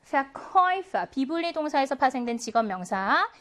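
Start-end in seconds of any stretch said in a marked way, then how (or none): 0.1-1.1 Verkäufer slow, with KÄU stressed; second-syllable stress. Shown as a demonstration. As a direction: model Verkäufer, raised and leaning on the second syllable. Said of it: "이 음절의 강세가 있습니다".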